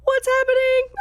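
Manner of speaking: high, loud squeal of bewilderment; utterly confused. Retold or imitated. Viewer reacting to a baffling Dracula scene, confused. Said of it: "I was so confused".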